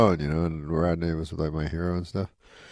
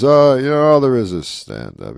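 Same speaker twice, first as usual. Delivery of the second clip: louder, higher Rodney insisting; Rodney Dangerfield impression; 6 semitones higher, 10 dB louder than usual. Rodney telling Norm only stand-up matters, louder. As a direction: declare it like hard-won wisdom. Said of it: "He goes".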